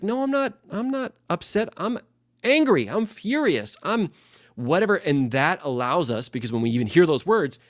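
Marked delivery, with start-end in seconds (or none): 0.0-5.0 put-on high, heated kid's protest; imitating his son insisting he is angry; mock angry kid. Son insists to dad he's angry, in a high heated voice. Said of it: "He's like".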